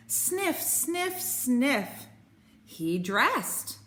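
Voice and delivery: ordinary delivery, nothing marked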